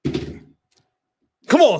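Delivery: high frustrated "come on"; exasperated. Real, not acted. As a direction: groan at your losing team. Frustrated at team.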